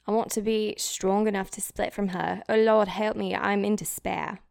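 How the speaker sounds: despairing British diarist, hammed up; British accent. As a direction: lament it in a put-on British accent. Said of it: "Oh, wait, British accent".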